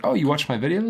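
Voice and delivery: high-pitched mock self-importance; kinda cocky. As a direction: ask it as if flattered by a fan. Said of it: "probably sounding kinda cocky".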